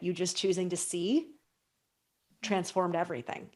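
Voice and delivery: usual speaking voice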